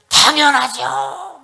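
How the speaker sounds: loud, high, drawn-out indignant cry; angry outburst. Acted. Jonah raging before God, angry. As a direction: blow up with veins popping, stretch it out. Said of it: "막 이렇게 핏대를 올리면서 하나님 앞에".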